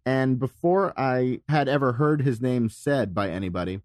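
normal talking voice

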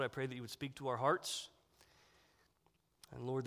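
ordinary talk, nothing marked

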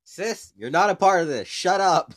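telling his sister to butt out, loud and sharp; loud snap at sister. Real, not acted. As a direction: tell your sister off loudly. Yells at sister interrupting.

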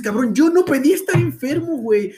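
a hurt, high indignant retort. Retold or imitated. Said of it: "Y eso dices".

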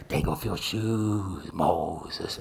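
deep, slow divine command; God voice. Acted. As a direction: intone it low and drawn out as God. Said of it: "It's my best God voice".

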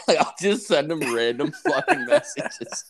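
high, laughter-broken delivery; laughing. Real, not acted. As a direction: crack up while explaining the prank. Cracking up at own prank messages.

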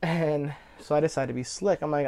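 ordinary talk

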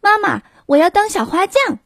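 put-on light, high little-boy voice; 小男孩兴奋的声音; eager child Tommy. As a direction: pipe it up like an excited boy. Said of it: "汤米说".

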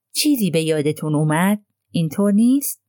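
ordinary delivery, nothing marked

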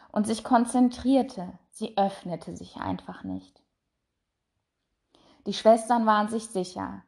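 ordinary delivery, nothing marked

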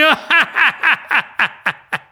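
high, loud cruel cackle; evil laugh. Acted. Dussasana laughing over Draupadi, a loud cruel cackle. Villain laughing after taunt.

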